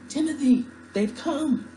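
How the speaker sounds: high, loud yell to a companion; excited yell. Acted. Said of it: "I yelled".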